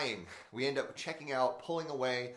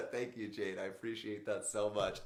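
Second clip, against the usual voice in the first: laughing; 5 semitones lower than usual. Real, not acted. Thanking a kind viewer comment.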